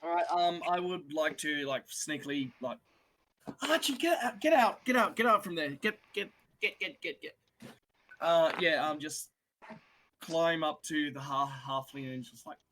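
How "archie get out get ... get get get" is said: hushed, high and hurried shooing; urgent hiss. Acted. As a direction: shoo a friend out of danger. Urgently shooing companion away.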